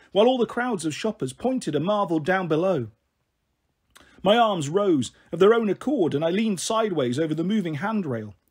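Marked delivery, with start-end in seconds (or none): none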